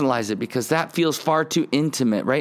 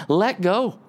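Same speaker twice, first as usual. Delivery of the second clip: high, pressing call to let go; urging plea; 7 semitones higher than usual. Retold or imitated. Preacher voicing David urging listeners, high and pressing. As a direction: push them to give in, pitch rising. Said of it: "And David's going".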